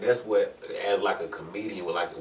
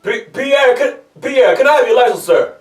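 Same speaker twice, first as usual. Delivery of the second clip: loud, high-pitched cop demand; police officer; 17 dB louder than usual. Acted. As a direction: shout it like an officer at a traffic stop. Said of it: "when the police officer came".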